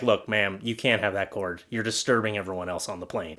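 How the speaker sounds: a hint of a firm, discreet reprimand; stern flight attendant. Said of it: "a stewardess or someone should go up there and be like".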